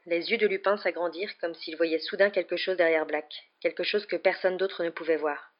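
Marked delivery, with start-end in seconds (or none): none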